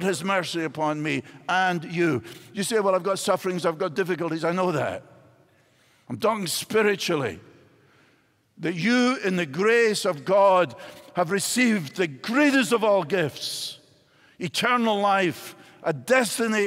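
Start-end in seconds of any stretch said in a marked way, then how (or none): none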